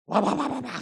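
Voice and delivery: a loud, high rapid-fire babble; pouring out words. Retold or imitated. Daughter pours out words.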